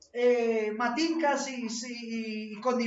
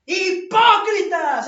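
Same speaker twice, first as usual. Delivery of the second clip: a slow, drawn-out yell, much higher and louder; shouted; 7 semitones higher, 10 dB louder, much slower than usual. Shown as a demonstration. Preacher showing how Jesus yelled at the Pharisees, full shout. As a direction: bellow it so the whole crowd hears. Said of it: "Les pegó el grito a la vaciada".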